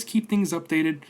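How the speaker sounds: ordinary talk, nothing marked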